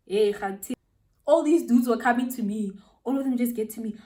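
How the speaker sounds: ordinary talk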